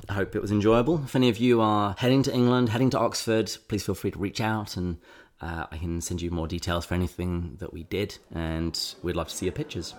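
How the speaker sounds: usual speaking voice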